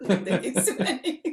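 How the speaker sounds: loud words through laughter; laughing. Real, not acted. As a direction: still cracking up. Laughing fit after disclaimer.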